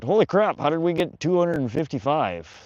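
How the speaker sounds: sudden high burst of surprise; surprised. Real, not acted. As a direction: blurt it out, caught off guard. Sudden jump in viewer count.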